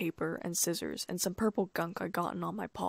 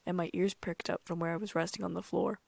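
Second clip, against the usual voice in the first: plain reading voice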